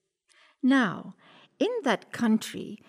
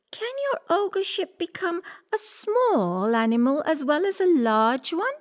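cat voice; 8 semitones higher than usual. Acted. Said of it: "Puss praised him ... said".

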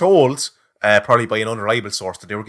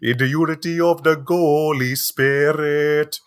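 mock Scottish priest accent; 3 semitones higher than usual. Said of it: "do it in a Scottish accent".

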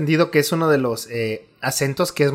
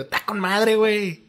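higher, lilting norteño sing-song; northern Mexican accent; 6 semitones higher, 4 dB quieter, slower than usual. Shown as a demonstration. Host contrasts central usage with the northern version, norteño accent. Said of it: "tiene que ser como el acentito".

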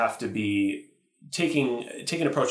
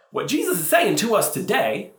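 a light Midwestern sermon voice, pitched high; Midwestern accent; 10 semitones higher, 4 dB louder than usual. Retold or imitated. The same priest slipping into his own Midwestern accent. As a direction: drop the brogue into plain Midwestern. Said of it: "that's actually not his accent, it was like a Midwestern accent".

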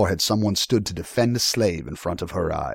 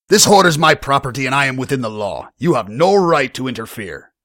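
loud, high, defiant retort; indignant tavern owner's defiance; 7 semitones higher, 8 dB louder than usual. A narrator's reading. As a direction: push back loudly, standing your ground. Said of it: "Vargas defiantly replied".

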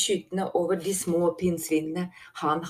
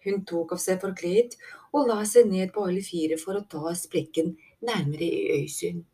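usual speaking voice

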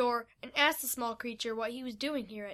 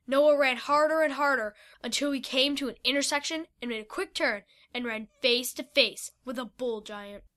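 plain reading voice

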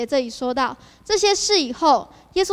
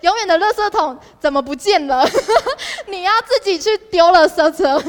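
mock outrage with a laugh, louder; laughing tease; 4 semitones higher, 6 dB louder than usual. Real, not acted. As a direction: laugh through it, teasing the broken promise. 调侃卡片承诺落空. (笑着调侃，带点假装的委屈)

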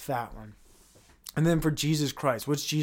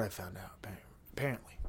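hushed confiding whisper; 7 semitones lower, 14 dB quieter, much faster than usual. Real, not acted. Speaker confides his discovery to listeners, whispering. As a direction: lean in and whisper it.

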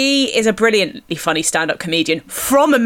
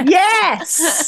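laughing cheer; 8 semitones higher than usual. Real, not acted. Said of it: "cheering while laughing".

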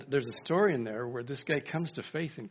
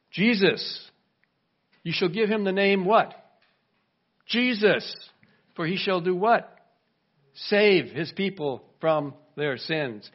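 louder, higher preaching drive; full-force preaching; 4 semitones higher, 7 dB louder than usual. Acted. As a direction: proclaim the name, building fervour.

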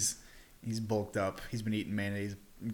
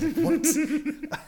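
laughing disbelief; 4 dB louder, much slower than usual. Real, not acted. Laughing at own mistake.

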